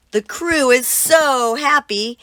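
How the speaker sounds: usual speaking voice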